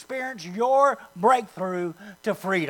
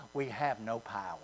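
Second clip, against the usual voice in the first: much softer, lower, sinking defeat on "We have no power"; depressed; 12 semitones lower, 13 dB quieter than usual. Shown as a demonstration. Pastor shows the congregation the defeated way, deflated. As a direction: let "We have no power" drop away, hopeless and quiet. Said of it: "not saying it in a depressed way".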